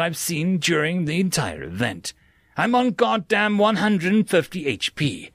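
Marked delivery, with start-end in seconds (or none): none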